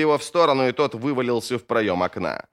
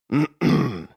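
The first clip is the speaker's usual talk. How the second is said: short throat clear; throat-clearing cough; 3 semitones higher than usual. Acted. Глокта interrupts the armed standoff, clearing his throat. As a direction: clear your throat before speaking up. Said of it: "Глокта кашлянул".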